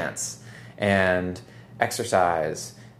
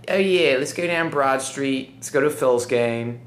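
playful put-on Philly accent, pitched higher; 5 semitones higher, 4 dB louder than usual. Shown as a demonstration. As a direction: slip into a hammed-up Philadelphia accent. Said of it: "I love the Philly accent".

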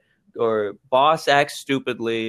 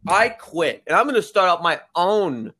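raised pitch, hammed-up defiant quitting; mock defiant secretary; 3 semitones higher than usual. Secretary quitting on her sexist boss, hammed-up and higher. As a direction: storm out higher, declaring you'll start your own. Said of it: "she said".

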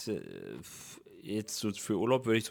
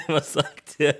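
quoting his own confusion while laughing, slowed; giggling out the baffled question; 4 semitones higher, 7 dB louder, much slower than usual. Retold or imitated. Laughing as he retells his confused reaction to the podcast listeners. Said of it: "ich war gerade so richtig verwirrt".

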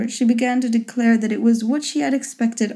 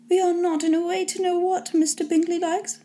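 high-pitched, lightly sulky mother voice; aggrieved mother in a high register; resentful mother; 8 semitones higher than usual. A narrator's reading. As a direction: voice a put-out mother, pitched well up. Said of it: "said m-, her mother resentfully".

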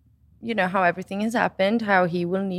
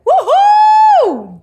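much higher and louder whoop of joy; jubilant whoop; 13 dB louder than usual. Pretend. Celebrating the parents' blessing, a much higher and louder whoop. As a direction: cheer it out. Celebrating after getting approval.

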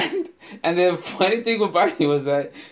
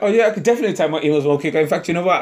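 eager rushed promise; faster than usual. Retold or imitated. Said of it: "You be like".

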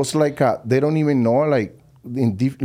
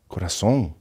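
lower, unimpressed repeat of the word; put-off echo; 5 semitones lower, 3 dB quieter than usual. Retold or imitated. Offended coworker echoing his endearment, lower and put-off. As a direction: imitate someone taken aback by an endearment.

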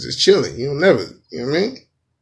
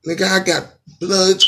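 normal talking voice

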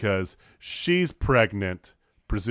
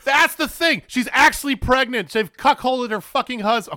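much higher and louder, all worked up; excited outburst; 13 semitones higher, 8 dB louder than usual. Real, not acted. Ranting to co-hosts about the storyline, loud and worked up. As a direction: blurt it out, fired up over the storyline. Erupting over the pregnancy angle.

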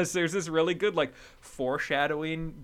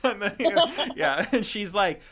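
ordinary delivery

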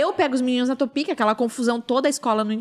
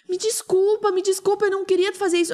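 higher-pitched imitation of someone apologizing; pleading apology; 8 semitones higher than usual. Reception girl apologizing to the mother, pleading. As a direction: beg forgiveness in a higher voice. Girl begging forgiveness, acted.

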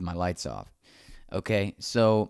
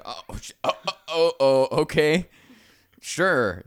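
flustered oh; 5 semitones higher, 5 dB louder than usual. Retold or imitated. Startled retold reply.

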